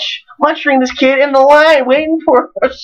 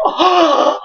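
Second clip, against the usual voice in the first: mock awed gasp; 6 semitones higher, much slower than usual. Grateful bystander gasps.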